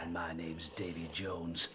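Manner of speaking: slow, scoffing whispered aside; sarcastic whisper. Acted. Blackmailer mocking skipper's excuse, slow sarcastic whisper.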